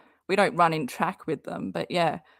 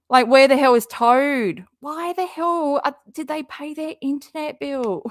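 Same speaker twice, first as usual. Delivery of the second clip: exasperated outburst; 7 semitones higher, 3 dB louder than usual. Real, not acted. Exasperated rant about missing Toad.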